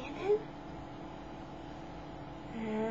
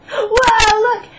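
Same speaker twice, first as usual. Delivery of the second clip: gasping whoa; 11 semitones higher, 14 dB louder than usual. Real, not acted. Amazed at filter.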